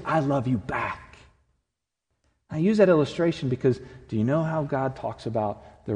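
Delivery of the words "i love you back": slow, soft answer of love; tender bride. Retold or imitated. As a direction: let the words linger, slow and gentle. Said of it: "she's gonna say".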